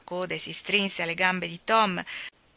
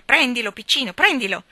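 loud, high coaxing shout; boy calling his dog into the water; urging call; 5 semitones higher, 8 dB louder than usual. Acted.

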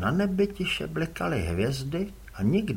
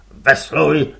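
louder barked order; gruff order; 10 dB louder than usual. Acted. Father roars at his son to row, gruff. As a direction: bark the order.

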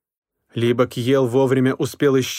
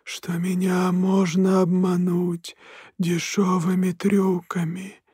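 slow, cold, eerie spirit voice; icy otherworldly voice; 6 semitones higher, 3 dB quieter, slower than usual. A narrator's reading. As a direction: greet the prince like a ghostly king. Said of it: "ледяным потусторонним голосом". (ледяной потусторонний голос)

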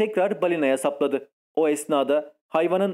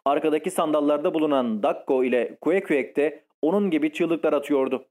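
ordinary delivery, nothing marked

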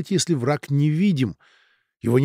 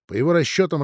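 ordinary delivery

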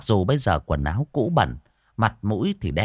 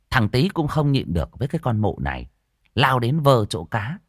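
ordinary delivery

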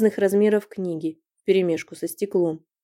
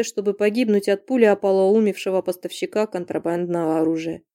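ordinary delivery

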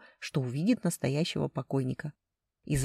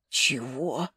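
croaked, disbelieving question; lightly acted hoarse "what?"; 3 semitones lower than usual. A narrator's reading. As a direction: voice suddenly gone husky with shock. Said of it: "осипшим голосом прохрипела Инна".

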